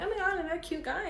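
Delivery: high, fast giddy squeal; excited gush. Retold or imitated. Retelling her thrill at another cute match, high and fast. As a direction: gush over a cute guy. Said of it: "and I'm like".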